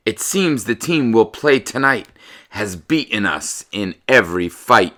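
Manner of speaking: loud, slow storybook reading; picture-book read-aloud, slow and lively; reading to children. Acted. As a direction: read the rhymes out to the class with relish.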